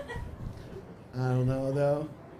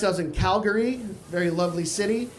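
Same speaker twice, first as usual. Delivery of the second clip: ordinary talk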